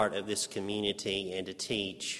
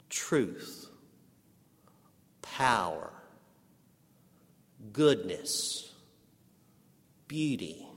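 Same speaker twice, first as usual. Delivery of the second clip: full-force preaching; slower than usual. Acted. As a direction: proclaim the vision in awe. Preaching Isaiah's vision.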